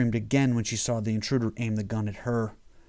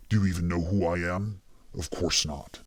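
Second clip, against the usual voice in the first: voice dropping lower; 4 semitones lower than usual. A narrator's reading. Damien taunts the intruder, voice sinking into a demonic bass. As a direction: lower and lower with each sentence, demonic. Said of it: "voice seemed to drop an octave with each sentence".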